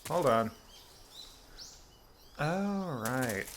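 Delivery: ordinary talk, nothing marked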